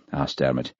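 ordinary delivery

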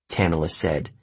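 plain reading voice

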